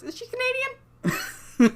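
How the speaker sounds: high, slow, laughing question; mock question. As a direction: stretch it out high while laughing.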